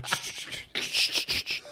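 breathy whispered shush. Real, not acted. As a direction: whisper a hush. Shushing during phone call.

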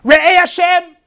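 high, loud cry of Re'eh Hashem; calling out. Retold or imitated. Bat Zion calling out to God in distress. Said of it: "she called out to HaKadosh Baruch Hu".

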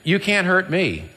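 high, slow mocking laugh-words; taunting mockery. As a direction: jeer at a beaten enemy, pitched high. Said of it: "He's making fun of death".

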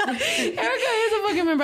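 high squeal through laughter; laughing. Real, not acted. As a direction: squeal it out while cracking up. Laughing about cousin's support.

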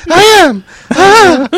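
high, loud mock confession; hammed-up yell while laughing. Mock echo of "I am.

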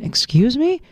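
high, startled excuse me; startled question. Retold or imitated. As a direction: react like you misheard. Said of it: "I'm going".

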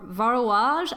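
Varouage said with a rising, unsure French try; clumsy French accent. Shown as a demonstration. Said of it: "My French accent is the worst".

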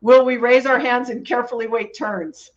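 usual speaking voice

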